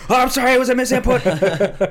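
very high, loud mock scream; hammed-up frantic pleading at full pitch; screaming streamer apology. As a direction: imitate a gamer yelling an apology. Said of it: "he starts screaming at the screen".

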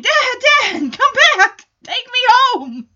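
high, loud panicked cry for Dad; imitated desperate scream; mock screaming. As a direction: holler it like a hammed-up abandoned kid. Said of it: "starts screaming".

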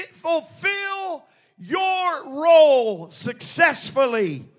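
slow, high preaching shout; loud sharp voice. Real, not acted. Preaching at full force.

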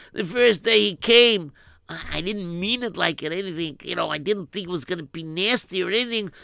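put-on fretting to himself in a Yiddish accent. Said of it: "he started thinking".

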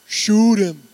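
loud Swamp People holler, imitated; imitated yell. Preacher mimicking a TV alligator hunter, loud holler. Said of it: "with somebody yelling".

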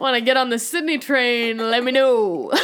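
hammed-up lonely hearts call; mock dating ad. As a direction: pitch yourself like a cheesy ad. Joking personal ad for herself.

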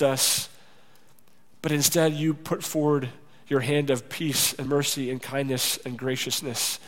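closing prayer. Real, not acted. Pastor leads the church in prayer: soft and earnest. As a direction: pray humbly over the congregation.

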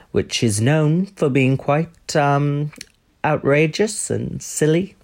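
slow, measured royal sponsor message; mock-dignified royal addressing listeners; Prince Andrew. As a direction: speak haltingly and deliberately, with long pauses.

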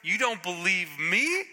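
incredulous rant building to a high shout; cranky angel. Acted. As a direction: blow up at the doubter. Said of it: "The angels get cranky".

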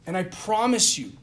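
usual speaking voice

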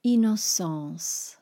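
innocence said slowly as French ee-no-sahns; French pronunciation. Shown as a demonstration. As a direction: innocence with nasal French end. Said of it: "Innocence becomes".

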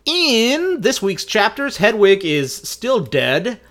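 slow, high, loud announcement; mock announcer. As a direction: proclaim it like breaking news. Announcer-style mock news.

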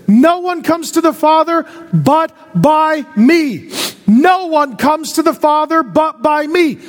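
high, emphatic preaching in punched bursts; full-force preaching. Real, not acted. Preacher declares Jesus the only way, full-force preaching.

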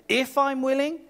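high, disbelieving question on IF I'M WILLING; indignant. Acted. Jesus repeating the man's doubt, indignant. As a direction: throw IF back, affronted and high. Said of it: "That's why Jesus was indignant".

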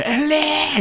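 a sudden high, imitated yell of the name; yelling Ellie. Retelling the game's father yelling for Ellie, high imitated yell. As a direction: call out for her like a desperate father. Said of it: "막 소리 지르잖아요".

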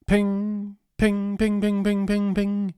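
high, ringing mock notification pings; rapid-fire "ping" on one high note; imitated phone pings. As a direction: chime like a phone going off repeatedly.

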